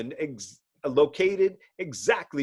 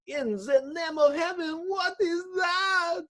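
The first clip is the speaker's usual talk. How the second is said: terrified shout. Acted. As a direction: scream at the sight of the monster. Monster appears, worker screams.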